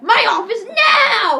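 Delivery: high, loud, drawn-out yell; hammed-up furious command; retold principal's order. Principal orders Eric to his office, loud mock yell.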